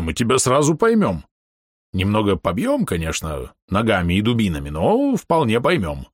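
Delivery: lightly voiced orc with swinging pitch; teasing half-orc. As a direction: coax and tease in a character voice. Narrator voices Bom joking about temptation.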